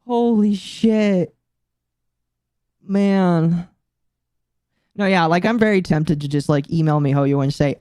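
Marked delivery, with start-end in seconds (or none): 0.1-3.7 slow stunned. Real, not acted. Stunned reaction.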